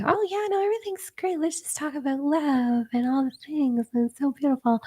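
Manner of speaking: very high, soft, airheaded voice; ditzy. Retold or imitated. Imitating ditzy friends who only talk of love, high airhead voice. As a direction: put on a dreamy, ditzy lilt way up high. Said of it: "They are ditzy. They're like".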